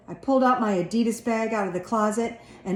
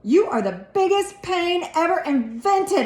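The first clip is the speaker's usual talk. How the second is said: high, angry shout at his brother; a boy yelling at his little brother; yelled; 10 semitones higher, 4 dB louder than usual. A narrator's reading. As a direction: yell it, fed up. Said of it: "I yelled".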